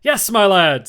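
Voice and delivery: put-on loud, hearty old sailor; hearty sea cook greeting the boy; Long John Silver voice. A narrator's reading. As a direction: boom it out like a jolly tavern keeper.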